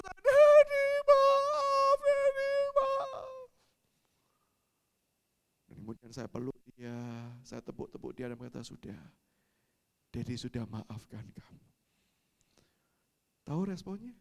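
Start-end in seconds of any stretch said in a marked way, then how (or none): 0.1-3.5 very high, loud wail; mock childish howling; imitated bawling child. Child rushes to hug, crying. 8.6-11.7 low, soft fatherly forgiveness; hushed, lowered comforting voice; tender reassurance. Retold or imitated. Father comforts crying child.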